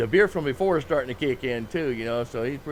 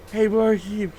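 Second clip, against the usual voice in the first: calling out; 7 semitones higher, 6 dB louder, slower than usual. Retold or imitated. Drunk patron calls bartender.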